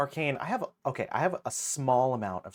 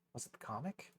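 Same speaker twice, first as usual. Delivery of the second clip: whisper; 12 dB quieter, faster than usual. Real, not acted. Searching quietly.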